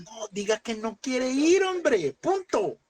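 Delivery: high, drawn-out emphatic demand; exasperated exhortation. Real, not acted. Preacher telling members to drop excuses, high exasperated cry. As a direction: cry it out high, stretching the words. Scolds listeners to stop making excuses.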